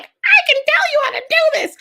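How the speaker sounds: very high, loud parody of a pushy coach; mock online business coach. Host mocking business coaches online, shrill hammed-up boast. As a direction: squeal it like an over-eager sales pitch. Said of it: "million business coaches online are like".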